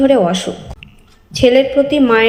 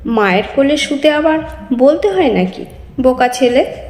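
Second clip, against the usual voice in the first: usual speaking voice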